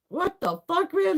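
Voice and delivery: high shocked outburst. Retold or imitated. Peeling the foot pad off, high shocked outburst. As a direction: yelp it in disbelief.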